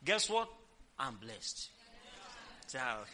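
usual speaking voice

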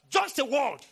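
sudden loud burst of preaching; emphatic declaration. Real, not acted. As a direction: punch it out forcefully.

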